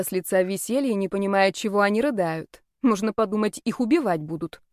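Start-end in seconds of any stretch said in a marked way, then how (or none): none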